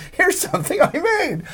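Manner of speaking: put-on high, slow, laughing eager-kid voice; mock-naive fan offering a gift; dorky young fan. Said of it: "I was an idiot".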